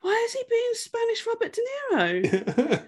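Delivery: high laughing disbelief. Retold or imitated. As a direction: squeal it through laughter. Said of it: "I was like".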